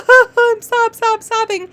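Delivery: high, hammed-up boo-hoo; mock sobbing. Teacher acting out the word sob, mock crying. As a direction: pretend to sob in a playful high voice. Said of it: "to sob means to cry".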